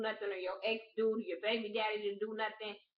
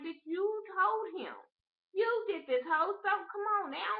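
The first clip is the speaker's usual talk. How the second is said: high-pitched, exasperated telling-off; exasperated scolding; 11 semitones higher, 3 dB louder than usual. Acted. Vlogger telling off the woman who told him, exasperated scolding. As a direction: scold her in a raised, incredulous pitch.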